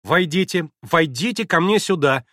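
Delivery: громко и настойчиво; приказывать, не терпя возражений; повелительный крик. Acted. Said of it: "настойчиво и повелительно закричала она". (повелительно и громко)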